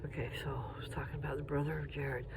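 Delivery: soft hushed whisper; breathy whisper, barely voiced. Real, not acted. Resumes quietly after a pause.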